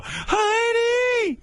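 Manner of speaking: screeching falsetto shout of Heidi; High Pitch Eric yelling. Retold or imitated. Heckler shrieking at judge Heidi from the audience. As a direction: bellow the name in a squeal. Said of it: "You'd hear him the whole show".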